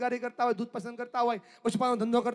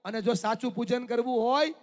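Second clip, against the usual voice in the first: ordinary talk, nothing marked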